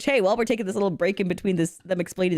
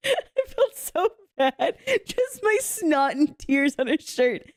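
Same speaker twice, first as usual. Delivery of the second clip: laughing embarrassment; 8 semitones higher, slower than usual. Real, not acted. Laughing about snot on fiance's shirt.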